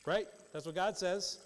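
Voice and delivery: ordinary delivery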